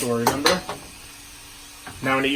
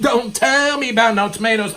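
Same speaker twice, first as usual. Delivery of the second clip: higher, louder brag; mock boast; 7 semitones higher, 4 dB louder than usual. Host bragging to his partner about the tomatoes. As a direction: brag like you own it.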